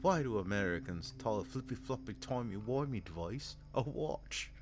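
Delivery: a hint of a smug Englishman teasing Americans; mock English accent. Mocking a narrator who teases Americans, light English accent. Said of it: "it's in an accent".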